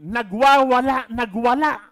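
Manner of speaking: nagwawala, nagwala punched very loud; emphatic. Shown as a demonstration. Pastor stressing Jesus' rampage, loud emphasis. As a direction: hit nagwawala and nagwala hard. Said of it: "pwede nga sabihin".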